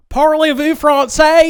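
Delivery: high, loud, slow mock French accent. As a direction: ham up a cartoon French accent. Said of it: "they're like".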